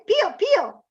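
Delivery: high chirpy pio pio; Italian chick peep. Shown as a demonstration. Teacher contrasting the Italian chick call, chirpy and high. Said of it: "In Italian".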